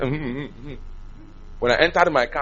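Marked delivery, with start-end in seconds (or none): 0.0-0.7 reluctant acknowledgement. Retold or imitated. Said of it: "I said".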